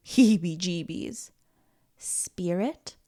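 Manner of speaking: ordinary delivery, nothing marked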